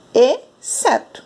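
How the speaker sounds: "Exceto" slowly split, EH, pause, SEH-tu; silent X. Shown as a demonstration. Teacher breaks the word up for pupils, silent X. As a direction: lift and separate the first e of "Exceto". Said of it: "não ouvimos o som do X".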